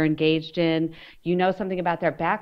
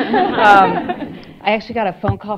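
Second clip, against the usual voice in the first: laughing apology; 4 semitones higher, 7 dB louder than usual. Real, not acted. Apologising for arriving late.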